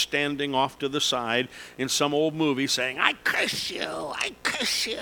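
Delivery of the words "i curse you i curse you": high, drawn-out witch cackle voice; old witch voice. Pretend. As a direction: screech it like a movie witch. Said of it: "That's my old witch voice".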